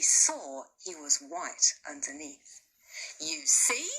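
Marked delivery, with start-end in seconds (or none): 3.2-3.9 triumphant cry. Acted. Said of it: "he cried triumphantly".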